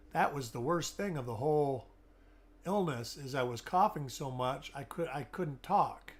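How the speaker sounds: ordinary talk, nothing marked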